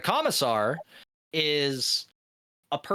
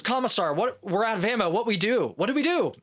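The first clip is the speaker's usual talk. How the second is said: higher, urgent plea to an officer; panicked soldier; 7 semitones higher than usual. Acted. Guardsman to commissar, out of ammo, panicking. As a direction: act out a soldier starting to panic.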